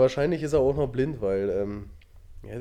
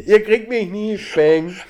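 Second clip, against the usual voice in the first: lachend rufen; 4 semitones higher, 7 dB louder, slower than usual. Pretend. Imagined robber taunting, laughing.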